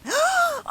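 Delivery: sharp frightened gasp, very high; startled gasp. Acted. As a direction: gasp in shock at the stranger. Startled by strange figure.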